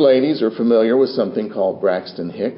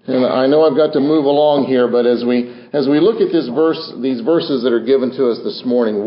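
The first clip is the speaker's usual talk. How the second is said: usual speaking voice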